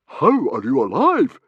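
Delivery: loud, high, slow amazed question; baffled. A narrator's reading. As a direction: ask it out loud, utterly astounded. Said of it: "Stoic's next baffled question".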